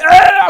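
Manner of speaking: startled yelp. Retold or imitated. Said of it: "I'm like".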